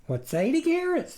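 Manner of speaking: high folksy farm wife; chirpy country wife voice; farmer's wife voice. A narrator's reading.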